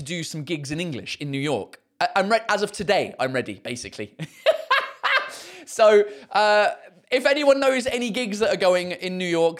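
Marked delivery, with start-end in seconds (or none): 4.2-5.4 high burst of laughter; sudden high laugh. Real, not acted. Laughing at own claim.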